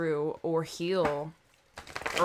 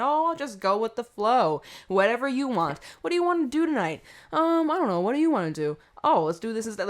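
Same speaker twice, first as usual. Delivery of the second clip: lightly acted, higher sing-song go-along voice; mock passive pushover; 5 semitones higher, 4 dB louder than usual. Imitating someone letting others pick tonight's plans, mock passive. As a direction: play an easygoing follower who defers on everything. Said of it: "very passive and, like, laxy-daisy".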